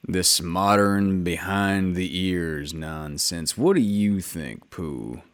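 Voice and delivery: low, slow, gloomy donkey grumble; grumbling Eeyore. A narrator's reading. Narrator voices Eeyore grumbling about washing, low and slow. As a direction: drag it out like a glum old donkey.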